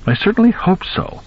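very high mock-dowager voice; comical dowager. A narrator's reading. Daughter answering her father, comical dowager's voice. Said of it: "in a comical dowager's voice".

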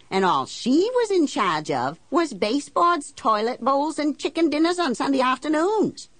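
high, indignant rant; bitter sarcastic rant. Acted. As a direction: build it into scornful outrage. Bitter rant about being wife.

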